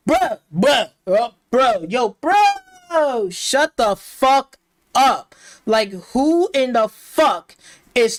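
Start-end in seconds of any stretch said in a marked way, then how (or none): 0.1-5.3 loud, exaggerated mocking "bro"; mocking impression. Reviewer mimicking the game's dialogue, mocking. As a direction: parrot the game character, high and punchy. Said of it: "you hearing this bitch say".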